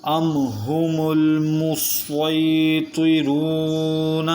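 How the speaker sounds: very slow, sung tajweed chant; Quran recitation. Shown as a demonstration. Teacher recites the third word for students, slow sung recitation. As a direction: sing the word out slowly. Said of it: "تیسرا حرف ہے".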